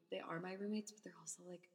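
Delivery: unvoiced whispered aside. Real, not acted. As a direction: drop into a whisper for a quick side remark. Aside about roommates, whispered.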